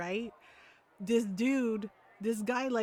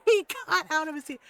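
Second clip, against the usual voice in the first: higher, louder, breaking into laughter; laughing; 5 semitones higher, 5 dB louder than usual. Real, not acted. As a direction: repeat it through real laughter. Cracks up repeating the line.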